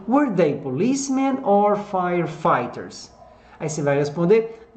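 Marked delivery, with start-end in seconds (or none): 0.1-3.1 slow model of "policemen or firefighters", falling at the end; careful English pronunciation. Shown as a demonstration. Teacher shows how to pronounce "policemen or firefighters", slowly and carefully. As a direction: stretch "policemen" and "firefighters" out for learners. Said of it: "de pronunciar aqui".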